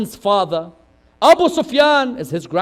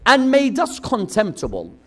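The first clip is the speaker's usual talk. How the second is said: preaching climax; 3 semitones higher, 7 dB louder than usual. Acted. Preacher building to Zainab's sermon, loud. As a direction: lift it up to the peak.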